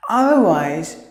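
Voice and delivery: ordinary delivery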